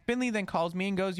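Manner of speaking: ordinary delivery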